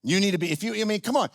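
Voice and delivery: normal talking voice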